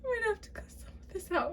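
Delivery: high, soft, rushed words through laughter; can't stop laughing. Real, not acted. Reader planning to cut the footage, helpless laughter. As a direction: squeak it out fast while giggling. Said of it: "I can't stop".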